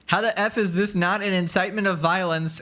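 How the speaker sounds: ordinary talk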